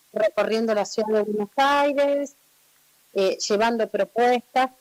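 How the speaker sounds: loud, boomy phone call right on the mic; too close to mic. Real, not acted. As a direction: speak with the phone pressed to your mouth. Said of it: "muy pegada al micrófono del teléfono".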